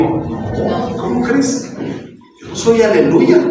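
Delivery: mock-innocent brush-off, starting soft; soft, incredulous little denial; dodging denial. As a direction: play Peter lightly denying his faith. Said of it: "y Pedro, ¿qué dijo?".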